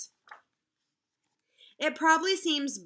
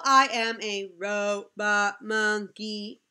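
slow, hint of a robot voice; lightly robotic monkey; 5 dB louder, much slower than usual. Teacher reading robot monkey line to kids, slow hint of robot. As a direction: drag it out like a little robot.

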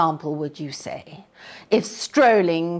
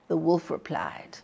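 usual speaking voice

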